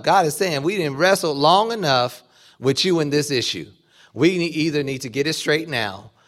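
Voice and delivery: loud, ringing preacher's cry; fervent preaching. Acted. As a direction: drive it home to the congregation at full force. Preaching at full force.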